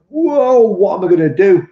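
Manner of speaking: a high, loud, hammed-up "whoa" of dismay over a broken tank; mock dismay. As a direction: play up the panic of the moment, high and loud. Retells dropping the tank.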